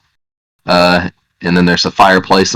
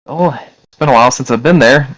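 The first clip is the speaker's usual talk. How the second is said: higher woman's voice, musing; 7 semitones higher than usual. Acted. Woman answering about the castle, higher woman's voice. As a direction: recall it as the woman, pitched up. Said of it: "a voice from a woman say".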